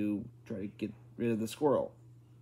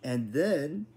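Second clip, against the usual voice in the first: higher, drawn-out "And then"; deliberate, emphatic; 5 semitones higher than usual. A narrator's reading. Mother pausing before the last instruction, pointedly deliberate. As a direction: hold them in suspense on "then". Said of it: "She said them more slowly".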